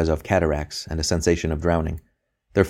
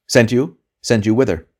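loud, higher sharp demand; 6 semitones higher, 6 dB louder than usual. A narrator's reading. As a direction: pounce on the answer. Interrogator pressing hard.

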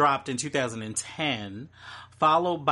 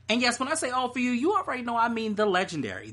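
fast, higher, building hype; hyped up; 10 semitones higher, much faster than usual. Real, not acted. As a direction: hype up the legend. Hyping [name] intro.

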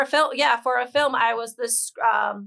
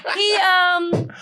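laughing; 9 semitones higher, 3 dB louder, much slower than usual. Real, not acted. Laughing while groping for a name.

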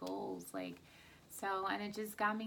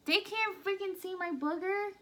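annoyed; 9 semitones higher, 4 dB louder than usual. Real, not acted. Wife annoyed at husband on camera.

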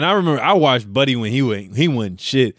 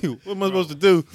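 quoting him while laughing; can't hold the laugh back mid-quote; laughing through a quote; 5 semitones higher than usual. Retold or imitated. Retelling the overwhelmed worker.

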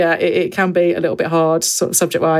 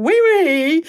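"wee wee" sung up high and playful; childish wee wee; 12 semitones higher than usual. Retold or imitated. Mother imitating her son's favourite French word, high and playful. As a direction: chirp "wee wee" up high like a giggling boy. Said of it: "by going".

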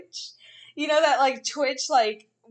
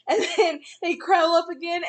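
ordinary talk

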